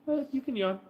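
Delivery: high soft cooing. Real, not acted. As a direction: speak up high to the pup. Talking to yawning dog.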